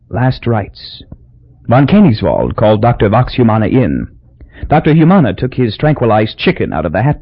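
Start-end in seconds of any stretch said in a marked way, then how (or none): none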